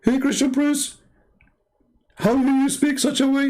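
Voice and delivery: high, sustained mimicry of a heckler; high-pitched mock outrage; mock scandalized critic. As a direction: imitate an offended accuser, voice way up.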